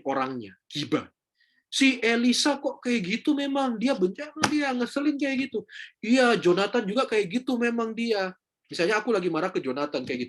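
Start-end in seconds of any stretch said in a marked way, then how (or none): 1.7-8.3 mock gossiping. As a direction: drag the friends down like a gossip. Acting out gossip about friends.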